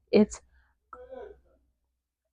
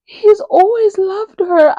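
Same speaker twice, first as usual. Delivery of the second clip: much higher, louder, slow stunned squeal; shocked disbelief; 11 semitones higher, 7 dB louder, slower than usual. Retold or imitated. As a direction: gush it out in disbelief. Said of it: "I was like".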